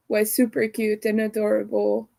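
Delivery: ordinary talk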